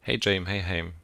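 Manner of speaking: ordinary delivery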